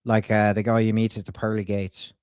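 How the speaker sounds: put-on Irish twang; Irish accent. Prank caller leaving a message with a staffer, in an Irish accent. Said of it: "she really liked my Irish accent".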